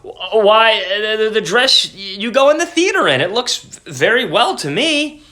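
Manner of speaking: high, flustered stammer. A narrator's reading. Husband caught off guard by wife, stammering. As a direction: trip over the words nervously. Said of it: "he stammered".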